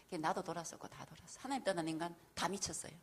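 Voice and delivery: normal talking voice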